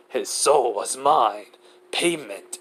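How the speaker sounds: slow Shinigami growl. Acted. Shinigami claims the soul as payment, slow growl. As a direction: growl it out slowly, word by word. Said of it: "Which he growls out".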